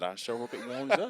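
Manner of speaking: imitated Southern local, amused. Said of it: "He said".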